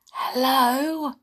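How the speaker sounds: plain reading voice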